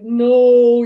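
sudden loud, firm no; hammed-up forceful no, overruling her; insistent no. Medium voicing the cat overruling her doubt, loud firm no.

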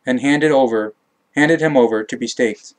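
plain reading voice

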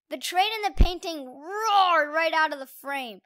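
usual speaking voice

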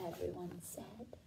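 ordinary delivery

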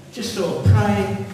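ordinary talk

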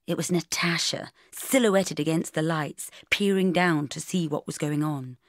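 ordinary delivery, nothing marked

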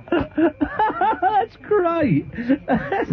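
helpless high laughter through the words; laughing hard. Real, not acted. Host losing it at a listener's joke, laughing. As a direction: crack up completely, squealing.

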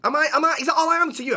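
high, outraged questioning; wounded indignation. Acted. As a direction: demand it, hurt and offended. Offended by low promotion offer.

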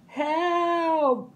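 high, drawn-out call; cry for help. A narrator's reading. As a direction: call out for rescue mid-fall. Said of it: "cried Clara".

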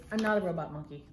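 usual speaking voice

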